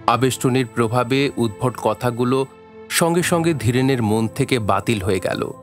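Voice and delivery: ordinary talk, nothing marked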